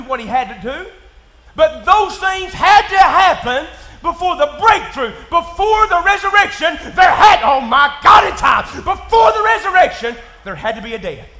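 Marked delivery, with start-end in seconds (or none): 1.6-10.2 loud, high, slowed shouting; full-force preaching. Acted. Pastor preaching toward the resurrection, loud and drawn out. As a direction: preach it with everything.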